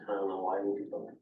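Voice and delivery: ordinary talk, nothing marked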